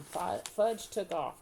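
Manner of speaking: plain reading voice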